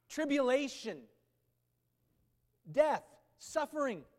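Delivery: loud, building scripture reading; a preacher pressing scripture on his hearers; forceful preaching. Acted. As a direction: read Romans out like a rallying cry.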